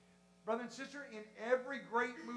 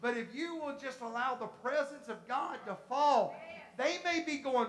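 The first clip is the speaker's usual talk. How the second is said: ordinary delivery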